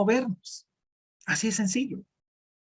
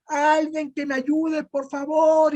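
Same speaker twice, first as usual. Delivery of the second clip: high, slow, pleading cry for help; man clinging to a branch begs for rescue; desperate cry for help; 7 semitones higher, slower than usual. Retold or imitated. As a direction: shout for help while hanging off a cliff. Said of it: "él gritaba y gritaba".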